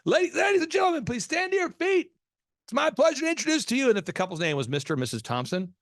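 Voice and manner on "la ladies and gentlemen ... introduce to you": a nervous wedding DJ building up the intro; nervous announcer. Retold or imitated. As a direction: imitate a DJ about to crack. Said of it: "So he'd go".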